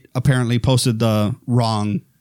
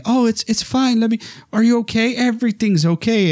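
high, fake-soothing motherly voice; soothing Mrs Voorhees; 10 semitones higher than usual. Killer mother soothing the terrified final girl, high and sweet. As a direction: coo it like a sweet mother hiding menace. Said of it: "and saying".

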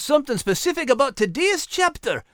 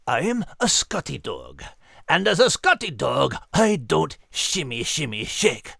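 dignified refusal; 8 semitones lower than usual. Acted. Scotty dog refusing to shimmy, much lower and dignified. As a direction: put your foot down with gruff dignity.

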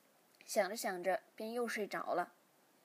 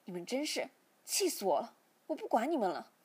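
exasperated, emphatic outburst; angry; 4 dB louder than usual. A narrator's reading. Father washes his hands of the couple, angrily exasperated. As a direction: give up on them in a louder huff. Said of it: "便生气地把台上的物品都扫到地上".

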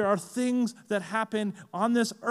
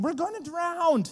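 high-pitched mock panic; acted alarm, voice leaping up high; panicked disciples; 9 semitones higher than usual. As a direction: cry it out like someone sinking.

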